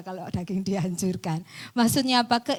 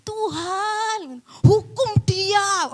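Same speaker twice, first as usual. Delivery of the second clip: high, drawn-out mock wail; mock crying protest; 10 semitones higher, 5 dB louder, much slower than usual. Preacher imitating an easily hurt believer crying to God, mock wailing. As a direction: wail it to heaven, exaggerated, getting louder. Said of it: "Kena didik nangis".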